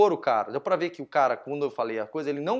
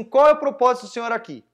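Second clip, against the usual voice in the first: raised, higher voice; retold insistent question; 7 semitones higher, 8 dB louder than usual. Challenging the louder man without a microphone, retold raised voice. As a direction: push the question loudly over someone. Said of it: "a voz dele já é mais alta que a minha".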